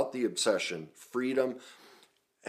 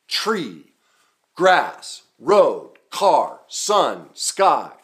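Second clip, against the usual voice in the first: loud, high shouts, one word at a time; calling out; 7 semitones higher, 13 dB louder, much slower than usual. Retold or imitated. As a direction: shout out each thing you spot as a separate burst. Re-enacts calling out what he saw.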